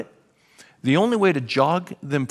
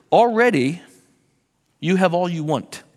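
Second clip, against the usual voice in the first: louder, mock-grand sarcastic jab; dripping sarcasm; 3 semitones higher, 4 dB louder than usual. Paul mocking the church's claim to be mature, sarcastic. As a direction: lay the sarcasm on thick, like a parent to a kid who's out of it. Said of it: "This is just dripping with sarcasm".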